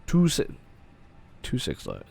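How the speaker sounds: ordinary talk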